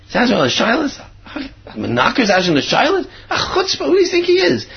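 high-pitched mock outrage; indignant rabbi. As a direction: act out an affronted, incredulous rabbi.

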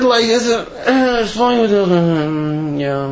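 slurred mumble, words swallowed; indistinct, glossed-over murmur; not so clearly. Acted. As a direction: mumble through "flowing with milk and honey" as if brushing past it. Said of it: "you know, not so clearly".

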